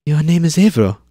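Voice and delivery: put-on high, eager girl's question; pestering girl. Zane pesters the big worker, high and eager. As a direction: keep nudging him like a curious kid. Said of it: "Zane pestered".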